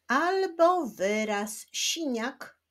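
normal talking voice

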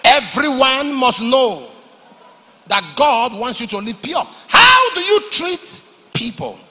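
loud, slow shouted preaching; a pastor shouting the lesson to his church; preaching at full force. Real, not acted. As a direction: thunder it out to the congregation.

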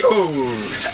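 ordinary delivery

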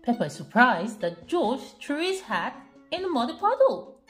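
ordinary delivery

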